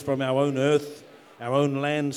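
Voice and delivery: usual speaking voice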